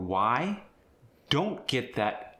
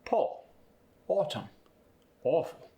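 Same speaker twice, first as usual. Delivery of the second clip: rounded British AW in Paul, autumn, awful; higher-pitched British Paul, autumn, awful with lips rounded; British AW, mouth barely open; British accent; 6 semitones higher than usual. Shown as a demonstration. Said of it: "are gonna become".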